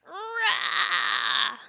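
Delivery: loud, high playful roar; dinosaur roar. Pretend. Playing dinosaur with kids.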